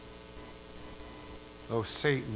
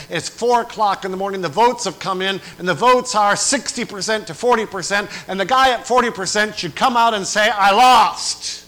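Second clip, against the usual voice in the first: higher and louder up to the loser's quote; building fiery preaching; 7 semitones higher, 10 dB louder than usual. Acted. Preacher telling of a losing candidate, building louder. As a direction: build to a roar.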